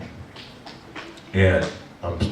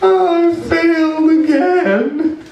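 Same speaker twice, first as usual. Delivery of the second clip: much higher, slow wailing sob; sobbing wail; 7 dB louder, much slower than usual. Retold or imitated. Retelling his breakdown on the ledge, a slow high sob. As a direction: draw it out in a broken wail. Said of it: "I'm crying".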